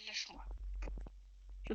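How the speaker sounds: hushed, breathless whisper. Real, not acted. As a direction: whisper while trapped and scared. Captured, whispering to partner.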